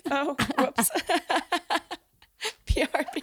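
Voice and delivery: laughing, high; giggling through the words. Real, not acted. Laughing at own mistake.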